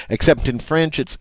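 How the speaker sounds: ordinary talk, nothing marked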